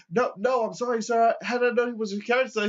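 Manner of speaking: high, loud nervous apology; the cop backing down to the old man, high and loud; flustered cop. Retold or imitated. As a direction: back off in a hurry, voice up high. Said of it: "he goes".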